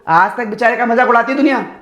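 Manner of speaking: usual speaking voice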